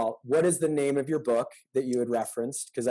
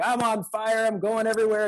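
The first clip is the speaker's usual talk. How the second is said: high, excited mock hype; mock fired-up; 8 semitones higher, 3 dB louder than usual. An attendee leaving an inspiring talk, hammed-up excitement. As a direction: play the pumped-up attendee, half joking.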